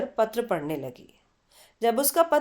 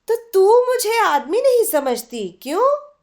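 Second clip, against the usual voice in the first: high, loud, stung comeback; indignant retort; 9 semitones higher, 6 dB louder than usual. Acted. Chitra stung by Aruna's jab, firing back high and loud. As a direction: snap back, offended.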